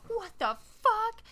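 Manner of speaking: high, drawn-out mock shock; stunned disbelief. [name] freaked out by the arm coming off, stunned.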